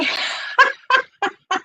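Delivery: bright, high burst of laughter. Real, not acted. Mother laughing about her daughter's honey, laughing.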